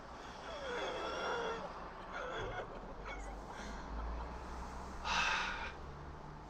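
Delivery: long drawn-out high sigh. Real, not acted. Long sigh while filming.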